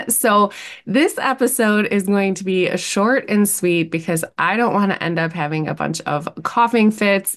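usual speaking voice

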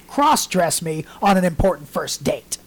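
usual speaking voice

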